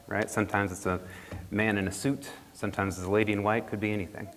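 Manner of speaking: plain reading voice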